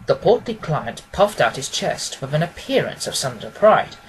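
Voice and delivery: usual speaking voice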